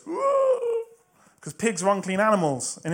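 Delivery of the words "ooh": high, sustained "ooh"; loud, held mock "ooh" of disapproval; crowd's shocked "ooh". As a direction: voice a scandalised crowd with one long high note. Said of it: "everybody that Jesus is talking to goes".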